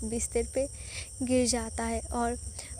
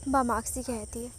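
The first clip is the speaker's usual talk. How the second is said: ordinary delivery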